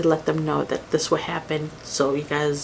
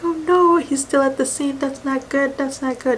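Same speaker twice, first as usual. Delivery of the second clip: high-pitched imitated distress, short of a scream; retold panicked screaming; 8 semitones higher than usual. Brother-in-law hears he's still at the scene, panicked. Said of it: "And just screaming".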